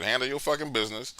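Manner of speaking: usual speaking voice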